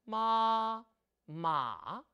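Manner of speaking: first and third tone, both long; long odd tones. Shown as a demonstration. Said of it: "길게 소리를 내죠".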